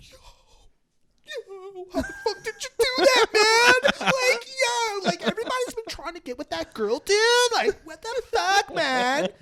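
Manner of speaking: high, loud amazed shouting; hyped-up friend. Retold or imitated. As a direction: yell it in disbelief, fast. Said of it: "He's like".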